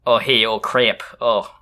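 slow drawn-out Michigander greeting; hammed-up Midwest "oh hey"; Michigan accent. Shown as a demonstration. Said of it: "What does a Michigander sound like?".

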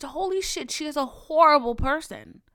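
high, emphatic retold shock; shocked realization. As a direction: blurt it out as a stunned discovery. Retold shocked realization about godmother.

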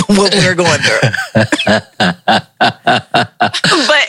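laughing through the words; giggling while she talks. Real, not acted. Laughing at own joke.

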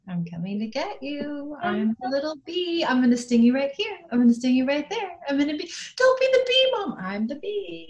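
high, squeaky playful bee. Pretend. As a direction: tease like a silly little bee. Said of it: "all kinds of funny, like, jokes".

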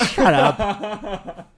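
slow laughing shut up. Real, not acted. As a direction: laugh it out, teasing. Laughing at friend.

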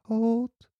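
high-pitched imitation of a little girl; put-on high little-kid voice. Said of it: "sounds like a little girl or a little kid say".

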